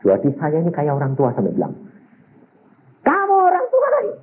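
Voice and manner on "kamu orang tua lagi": mock scolding parents. Preacher quoting himself scolding parents.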